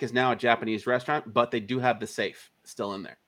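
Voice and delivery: ordinary talk, nothing marked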